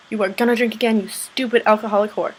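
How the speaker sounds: loud, hammed-up telling-off; exasperated scolding. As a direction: scold a fictional character in mock outrage. Ranting at a character, exasperated.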